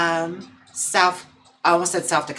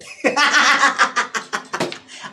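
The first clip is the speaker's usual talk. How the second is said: high burst of laughter; 8 semitones higher, 4 dB louder than usual. Real, not acted. Big laugh.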